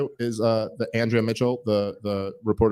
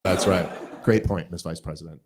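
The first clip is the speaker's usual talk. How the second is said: sarcastic fawning; 8 semitones lower, 4 dB quieter than usual. Pretend. Sarcastic fake praise.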